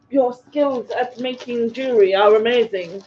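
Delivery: put-on playful posh English accent. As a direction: put on a plummy posh English voice to read the compliment. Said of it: "doing that in my posh accent".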